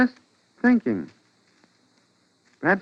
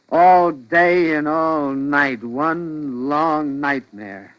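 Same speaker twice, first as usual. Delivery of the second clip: slow, dragging each word out; husband wearily telling his clinging wife; slow weary; much slower than usual. Acted. Slow weary drag.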